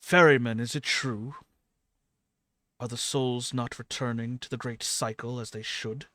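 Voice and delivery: loud, forceful godly questioning; imposing god demanding answers. Acted. A thunderous-stepping god questions the Ferryman, loud and demanding. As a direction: hit the first word hard, then press the question quickly.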